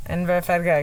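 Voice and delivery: Inverfargag run together fast, Scottish-style; quick Scottish try. Shown as a demonstration. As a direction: rattle off Inverfargag like a local. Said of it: "let me do it again".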